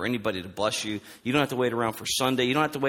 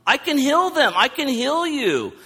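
very high, insistent, obvious-as-day retort; Jesus, emphatic duh; 12 semitones higher, 4 dB louder, slower than usual. Retold or imitated. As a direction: throw it out loud and high, as if obvious. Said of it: "And Jesus is like".

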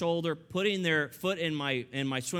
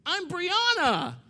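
put-on very high, loud, drawn-out kid voice; little girl's shout; 8 dB louder, slower than usual. Five-year-old introducing herself to the man she climbed, gleeful shout.

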